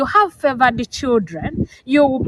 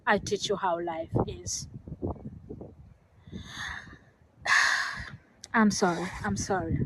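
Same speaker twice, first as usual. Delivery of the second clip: real emotion, softer, words falling away; getting emotional; 13 dB quieter than usual. Real, not acted. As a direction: voice fading into a sigh, holding back tears. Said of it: "sometimes I just get emotional when I talk about my story".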